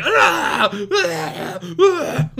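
mock screams of pain. Victim screams as his Achilles is cut, hammed-up screams. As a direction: scream in bursts like a cheap horror victim.

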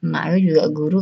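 ordinary talk, nothing marked

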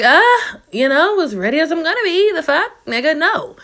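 high, jittery reply; dreading it, say you're barely ready; squeaky nervous answer; nervous reluctance. Retold or imitated. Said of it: "I'm just like".

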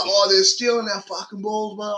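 whiny old man mock. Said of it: "they're like".

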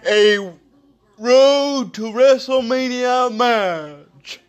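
booming wrestling announcer promo; big ring announcer hype voice; dramatic reality show hype. Acted. Wrestling event promo intro.

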